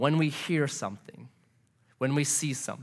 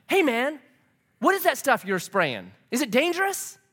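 higher-pitched imitation of a worried questioner; mock questioning; 8 semitones higher than usual. Roommate questioning the masked exterminator, mock-worried. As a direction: lift the pitch as if pestering a stranger.

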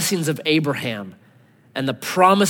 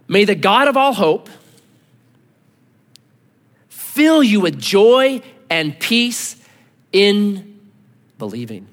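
slow, swelling reading of the blessing, fading at the end; scripture read; 5 semitones higher, 6 dB louder, slower than usual. Acted. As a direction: proclaim it slowly, then let the last word drop. Reads Romans blessing slowly.

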